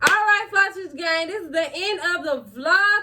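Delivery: high, singsong outro to viewers; peppy high-pitched YouTuber outro; vlog sign-off. Acted. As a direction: sign off high with stretched line endings.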